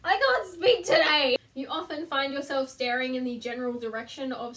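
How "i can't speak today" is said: loud, high, drawn-out self-reproach; exasperated outburst. Real, not acted. Vlogger annoyed at own stumble, exasperated outburst. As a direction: burst out in frustration at yourself.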